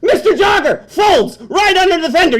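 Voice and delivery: high, loud startled shout; shocked outburst. Acted. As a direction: yelp at the crash. Witnessing the jogger hit.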